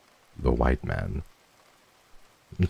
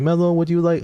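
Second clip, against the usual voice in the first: very high, sweet quoted voice; sweet imitation; 5 dB louder than usual. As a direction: imitate a sweet friend, pitched way up. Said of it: "was so sweet".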